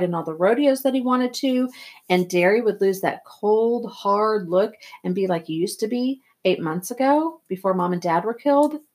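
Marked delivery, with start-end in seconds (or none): none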